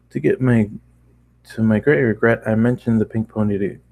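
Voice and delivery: usual speaking voice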